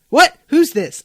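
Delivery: loud, high startled yelp; startled phone answer. Acted. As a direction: snap back at a mystery caller. Acted startled prank call victim.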